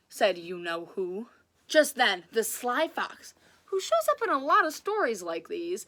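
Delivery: lively read-aloud storytelling voice; animated children's story reading; story narration. A narrator's reading. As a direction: read it like a picture book to kids.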